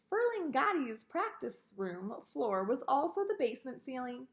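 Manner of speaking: ordinary delivery, nothing marked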